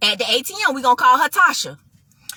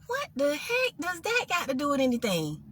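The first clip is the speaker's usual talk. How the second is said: much softer, puzzled muttering; hushed, baffled private question; inner thought; 2 semitones lower, 11 dB quieter than usual. Retold or imitated. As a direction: think it aloud under your breath. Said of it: "So in my head, I'm like".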